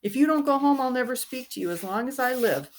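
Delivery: high, loud furious threat; really angry. Acted. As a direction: fling it at the boys in rage. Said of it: "Beezus was really angry".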